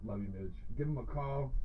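usual speaking voice

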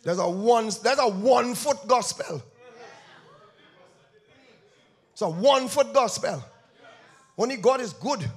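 loud, high, sing-song preaching with rising fire; full force preaching. Acted. Preacher warns the church against cheap grace, shouting. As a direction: thunder it out to the congregation.

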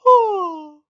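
big, loud acted yawn; hippo's exaggerated yawn. A narrator's reading. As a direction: yawn the word out high and long. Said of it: "he yawned".

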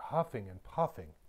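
usual speaking voice

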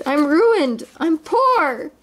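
high, wailing acted despair; hammed-up storybook misery; despairing groan. A narrator's reading. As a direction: lament with rising pitch. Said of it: "groaned Scrooge".

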